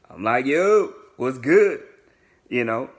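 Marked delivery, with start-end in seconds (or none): 0.5-1.8 louder, higher, drawn-out hello; hearty greeting. Retold or imitated. Greeting a frat brother back, hearty hail. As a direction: stretch it out to greet an old buddy.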